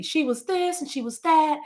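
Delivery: lightly voiced high eulogy quote; mock eulogy speaker. Mimicking mourners at her own eulogy, voiced high. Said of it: "they just kinda say".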